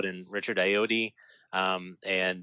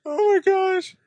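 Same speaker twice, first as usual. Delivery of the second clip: laughing exclamation; 5 dB louder, slower than usual. Real, not acted. Laughing at the scene.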